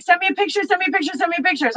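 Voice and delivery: lightly mocked pushy guy. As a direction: mimic a pushy pestering man. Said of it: "some guy's like".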